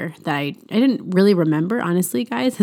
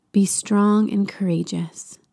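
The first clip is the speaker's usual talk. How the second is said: whispered verse; 2 semitones higher, slower than usual. Shown as a demonstration. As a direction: breathe the verse out slowly in a whisper.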